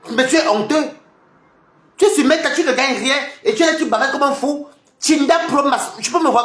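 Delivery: mocking scorn. Real, not acted. Mocking a rival online.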